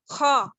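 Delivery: خا with a rough throat scrape; khaa demo. Shown as a demonstration. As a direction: scrape out خا loudly. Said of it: "clear difference موجود ہے". (خا حلق سے رگڑ کر)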